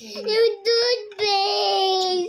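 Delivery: very slow tearful wail; crying. Real, not acted. Child blaming the toothpaste, crying. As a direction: drag the words out in a sob. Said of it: "Stop crying, sweetie".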